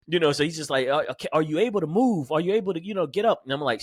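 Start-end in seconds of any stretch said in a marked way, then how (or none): 0.9-3.4 higher voice, gentle questions; concerned sergeant. Retold or imitated. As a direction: check if he can move. Sergeant asks if he can move.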